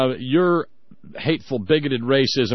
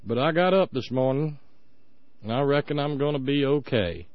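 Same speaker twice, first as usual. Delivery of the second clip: slow, soft Southern country drawl; host imitates an Alabama friend in a slow Southern drawl; 6 dB quieter than usual. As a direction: lay on a lazy Southern accent. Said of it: "They sound Southern".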